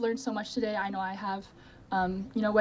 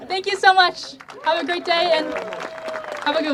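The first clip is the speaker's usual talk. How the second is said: high, loud laughing thank-you; laughing excited thanks; 9 semitones higher, 6 dB louder than usual. Real, not acted. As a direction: burst out thanking everyone with a laugh. Excited thanks after selfie.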